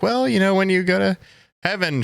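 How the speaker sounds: high, careful placating voice; placating relative. Retold or imitated. Gently placating a bereaved old woman.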